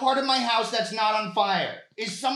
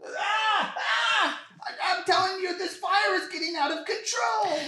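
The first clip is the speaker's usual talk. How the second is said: panicked shout; 10 semitones higher than usual. Acted. Caller panicking at the dispatcher as the fire spreads, yelling high.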